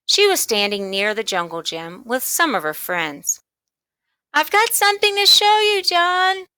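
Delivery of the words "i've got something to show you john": high, loud call across the playground; girl shouting across the schoolyard. A narrator's reading. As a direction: call out to John eagerly. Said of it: "she shouted".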